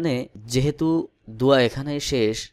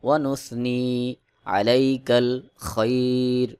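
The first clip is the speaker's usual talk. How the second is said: slow, measured Arabic chant with long-held vowels; Quran-style recitation; 4 dB louder, much slower than usual. Shown as a demonstration. Teacher reciting Dua Qunut for learners to memorise, slow chanted recitation. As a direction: recite phrase by phrase, pausing for learners to follow. সেভাবে এবার পড়ে শোনাব.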